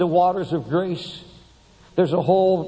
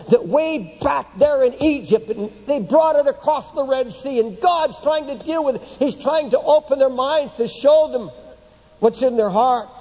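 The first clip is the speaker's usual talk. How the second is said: raised, building preacher voice; preaching; 5 semitones higher than usual. Acted. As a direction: preach it higher and harder.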